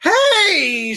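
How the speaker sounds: high, loud startled scream. Acted. Annabeth yanked from the game, screaming high and loud. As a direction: yelp as she is yanked away. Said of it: "she screamed".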